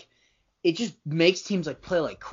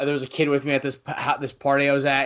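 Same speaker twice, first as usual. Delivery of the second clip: ordinary delivery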